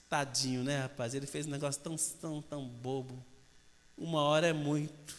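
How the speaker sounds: soft, low, relenting murmur; pitying mother. Acted. Mother hears her son crying, pitying him. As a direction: think aloud tenderly, voice dropped.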